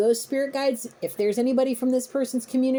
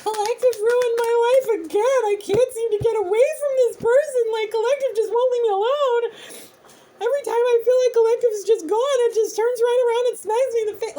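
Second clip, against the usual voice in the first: much higher, louder whiny complaint; childish whining; 11 semitones higher, 4 dB louder than usual. Retold or imitated. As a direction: whine like a petulant victim. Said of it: "beyond belligerent and childish".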